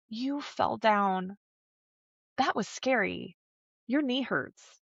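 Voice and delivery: put-on slow, soft, even narration to a child; calm, regulated nurturing. Mom narrates to fallen toddler, acted calm reassurance. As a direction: gently state it, unhurried. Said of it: "regulated, middle of the road response".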